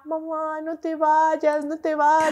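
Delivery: put-on high, drawn-out childish begging; imitated pleading children. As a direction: mimic kids clinging to their mother. Mother voices children begging her. (imitando a niños que suplican)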